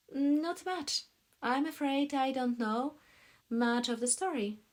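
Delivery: high, loud female character; the woman's part, pitched well up; woman character's voice. A narrator's reading. Woman answers in read dialogue.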